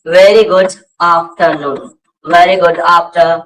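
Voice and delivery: Very गुड afternoon said slowly, word by word; measured teacher's demonstration; slow model phrase. Shown as a demonstration. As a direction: space out the greeting for repetition. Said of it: "क्या बोलेंगे?".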